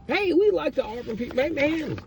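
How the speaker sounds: high-pitched voice of friendly rival fans; hospitable Baylor fans. Acted. Baylor fans welcoming Auburn people, hospitable and high-pitched. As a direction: put on a welcoming fan's high voice. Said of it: "very hospitable".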